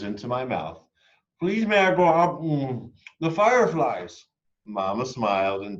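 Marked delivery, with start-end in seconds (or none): 1.4-4.3 put-on high, louder eager plea of a child; eager child pleading. A narrator's reading. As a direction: beg like a kid who can't wait. Child begs parents to go out.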